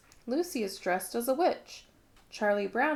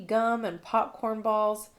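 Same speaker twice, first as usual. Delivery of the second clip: ordinary delivery, nothing marked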